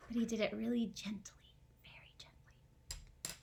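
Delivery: hushed gentle whisper. Acted.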